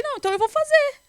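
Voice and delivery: retelling her own nervous outburst at the doctor, high and loud. Retold or imitated. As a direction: blurt it out high and loud, nerves taking over. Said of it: "eu tenho uma reação estranha".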